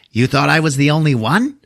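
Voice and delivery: put-on high voice of a gloating killer doll; Chucky. Living doll head laughing at its captor, taunting put-on voice. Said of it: "laughs at him and goes".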